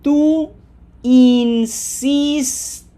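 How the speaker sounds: "tú insistes" high, loud, syllables stretched out; exact slow model. Shown as a demonstration. Teacher modelling for learners, "tú insistes" slow, loud and emphatic. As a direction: draw out "insistes" with smiled ee sounds, pushing on "sis". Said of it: "Say it exactly like that at that speed".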